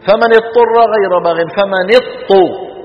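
mock the wrong reading of فمن اضطر; common mistake. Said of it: "شو بيقولوا".

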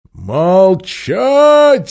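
booming loud command; booming loud order; thundered. Acted. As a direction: roar it to silence the crowd. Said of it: "загремел Рижда Тархан".

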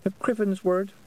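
put-on high little-child voice; sheepish child's mumble. A narrator's reading. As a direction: finish the rule as a chastened toddler. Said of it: "Wentworth muttered".

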